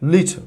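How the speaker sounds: "লিটল" with a weak, swallowed last syllable; স্বাভাবিক ইংরেজি উচ্চারণ. Shown as a demonstration. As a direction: say "লিটল" short, almost no vowel before the final l. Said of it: "দেখেন আমি কি বলছি". ("লিটল"-এর শেষ syllable দুর্বল করে বলা)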